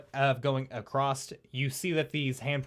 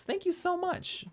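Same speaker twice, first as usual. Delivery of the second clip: high, bright gushing thank-you; delighted thanks; 4 dB quieter than usual. Real, not acted. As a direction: squeal your thanks to the viewers. Streamer thanks viewers for gifts.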